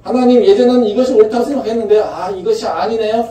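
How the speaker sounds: high, loud, fast cry to God; fervent outcry. Acted. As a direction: rush the confession out at full voice. Climactic confession, fast and loud.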